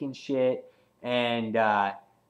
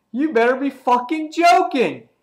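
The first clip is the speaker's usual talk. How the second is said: loud, high mock outrage; raised, high-pitched incredulous retort; acted incredulity; 13 semitones higher, 9 dB louder than usual. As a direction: act out a cocky villain's disbelief. Acting out Negan's cocky outburst.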